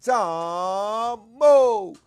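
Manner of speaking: loud, high response shout of the name; crowd response shout. Shown as a demonstration. Crowd answering the host's call, shouted high and loud. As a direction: yell it back like the crowd. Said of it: "y'all supposed to be right with me".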